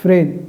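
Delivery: Friend isolated and clipped off as the stressed part; stressed syllable alone. Shown as a demonstration. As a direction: say just friend, cut short. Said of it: "first syllable होगा".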